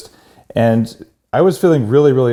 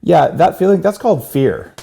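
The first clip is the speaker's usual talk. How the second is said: the psychologist quoted higher and louder, driving home the point; knowing reveal; 9 semitones higher, 4 dB louder than usual. Retold or imitated. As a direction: deliver the diagnosis with a knowing punch. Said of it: "she looks at me and she goes".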